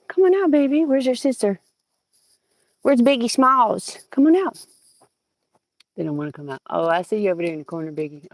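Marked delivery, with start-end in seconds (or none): 0.1-4.7 high coaxing call to the hens; calling pets. Real, not acted. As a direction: coax them out, high and loud. Calling chickens out of coop.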